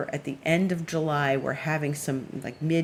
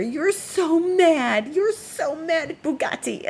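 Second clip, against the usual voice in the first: high, drawn-out teasing; stretched-out, high-pitched mock sympathy; teasing coo; 11 semitones higher, slower than usual. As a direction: tease a sulking pet in a high voice. Teasing her offended cat.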